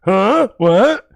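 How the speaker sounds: high, flustered what huh; guilty playing dumb. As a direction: act clueless to dodge blame. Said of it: "I go".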